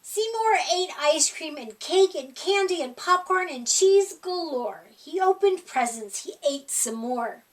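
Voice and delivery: usual speaking voice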